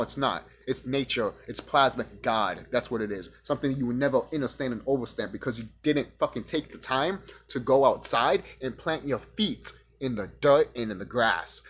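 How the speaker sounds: ordinary talk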